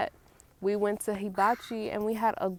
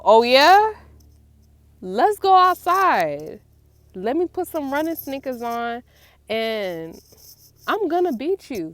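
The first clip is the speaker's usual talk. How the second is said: loud, higher, slow taunting "Let's go outside"; cocky challenge; 5 semitones higher, 9 dB louder than usual. Pretend. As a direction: rise to the challenge boldly. Grandma answering the challenge.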